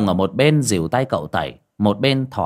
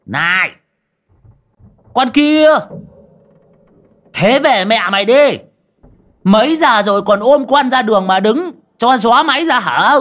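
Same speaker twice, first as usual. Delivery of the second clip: much higher, much louder yelling; furious shout; 12 semitones higher, 9 dB louder than usual. A narrator's reading. Drunk driver yelling at a woman on the road, furious shout. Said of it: "Ông Đồng quát".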